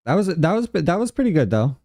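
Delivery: excited. Real, not acted. Excited after first call attempt.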